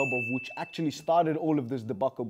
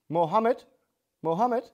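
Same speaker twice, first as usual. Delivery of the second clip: calling out; 6 semitones higher than usual. Acted. Calling the correspondent.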